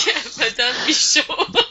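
laughing through the name. Real, not acted. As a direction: say it with a chuckle. Amused at the name.

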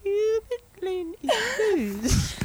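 very high, slow, hammed-up British accent; theatrical British accent. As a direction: declaim it like a Shakespeare actor. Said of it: "Sound like Shakespeare".

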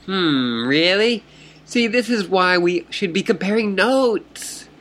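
put-on high, bubbly fish girl voice; excited fish troll. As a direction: greet her like an eager fish troll.

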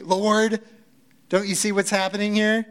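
hammed-up complaint to someone who should notice; snarky Martha. Martha demanding that Jesus notice, in a snarky tone. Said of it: "of what she's saying?".

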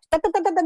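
high-pitched rapid "da-da-da" burst; imitated arrow volley. Wife likening pent-up feelings to arrows, imitated volley. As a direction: fire off syllables like flying arrows. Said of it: "好像射箭一样".

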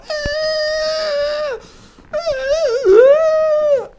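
very high pained cry-shout; distressed yelp. Retold or imitated. Kid crying out at the opticians from an eye drop. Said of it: "Like Rain Man".

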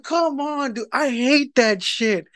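high, pleading complaint; exasperated protest. Retold or imitated. Husband objecting to the shirt he hates, exasperated.

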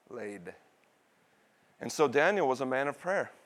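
ordinary delivery

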